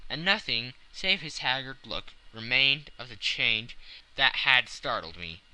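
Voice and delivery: usual speaking voice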